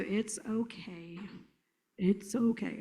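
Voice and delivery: slow, hushed, gloomy drawl; Eeyore. Acted. Trainer showing a child's slow cadence, glum and hushed. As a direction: drag it out low and soft. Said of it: "you know, Eeyore".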